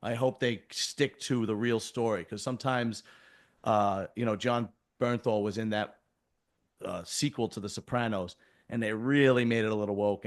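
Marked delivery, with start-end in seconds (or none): none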